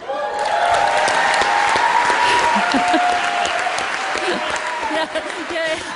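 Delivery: high breathy laughter; long airy laugh. Real, not acted. Delighted about perfect test results, long airy laugh.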